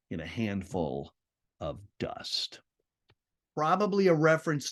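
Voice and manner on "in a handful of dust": soft, then breathed out; fading to a whisper. Acted. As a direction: let the line die into a whisper. Poem line fading out.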